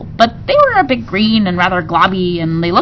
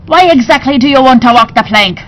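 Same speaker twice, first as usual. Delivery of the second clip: pirate queen voice; 5 semitones higher, 5 dB louder than usual. A narrator's reading.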